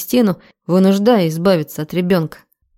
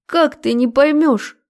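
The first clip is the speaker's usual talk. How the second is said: high, pleading question; higher, impassioned female voice; exasperated woman; 7 semitones higher than usual. A narrator's reading. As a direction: beg him to see it. Desperate woman pleads to be understood.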